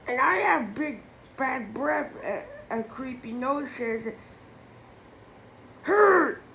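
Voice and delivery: high, loud complaining; yelling. A narrator's reading. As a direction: yell the complaint. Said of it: "yelled the guy".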